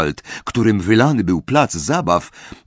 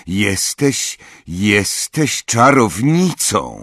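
usual speaking voice

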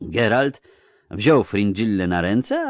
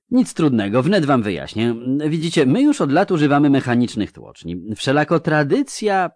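higher-pitched, eager official explaining; lightly voiced ceremonious chamberlain, pitched up; courteous pompous chamberlain; 8 semitones higher, 5 dB louder than usual. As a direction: explain it with courtly self-importance.

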